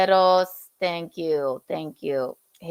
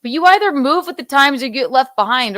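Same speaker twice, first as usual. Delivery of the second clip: ordinary delivery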